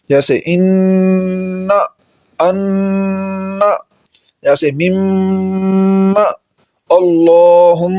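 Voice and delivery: ان and مم hummed long and slow through the nose; teacher demonstrating ghunna nasalization; nasal ghunna demo. Shown as a demonstration. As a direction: hold the nasal hum in اللہم, very slow. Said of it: "غنے میں آواز کو ناک سے پیدا کیا جاتا ہے".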